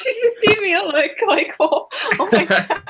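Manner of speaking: high, helpless giggly telling; talking through laughter. Real, not acted. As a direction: get the words out while cracking up. Player cracks up over mom's look.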